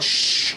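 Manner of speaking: drawn-out "shh"; shushing sound; long shushing. Acted. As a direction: hush the room with a long hiss of air. Title of his hangover book.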